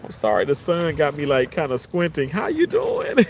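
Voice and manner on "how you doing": silly high greeting. Pretend. Greeting a viewer again as a joke, high put-on voice. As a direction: jump way up high into a playful put-on voice.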